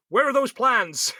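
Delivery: put-on Darth Vader in a West Country accent. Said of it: "with his West Country accent".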